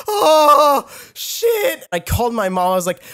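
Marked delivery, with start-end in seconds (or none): 0.1-1.9 loud, high mock wail; retold crying panic. Patient retelling his reaction to a leukemia scare, mock wailing. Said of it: "I started crying".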